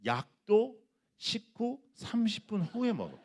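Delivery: high, slow, laughing mock child voice; sing-song childish excuse, laughing through it; a preacher mimicking a kid's lazy excuse with a laugh.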